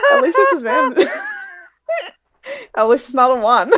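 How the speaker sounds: words through real laughter; laughing hard while talking. Real, not acted. Player laughing at her roll.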